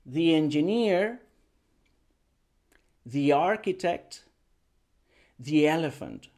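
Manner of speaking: "the engineer", "the architect", "the elephant" slowly, "the" as linked thee; diphthong link. Shown as a demonstration. Teacher models all three examples, linked thee. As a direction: glide "the" into each vowel. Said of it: "creamos este diptongo".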